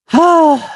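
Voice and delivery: high, falling sigh; pitying sigh. Acted. Storyteller reacts to Tony's failure, pitying sigh. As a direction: let out a long, sympathetic sigh. Storyteller pities the failed Tony.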